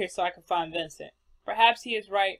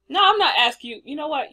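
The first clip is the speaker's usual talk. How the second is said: higher, louder sudden protest; mock indignant outburst; 8 semitones higher, 9 dB louder than usual. Player protesting at a dialogue option, mock indignant loud burst. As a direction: snap back in mock offence. Player snapping at game choice.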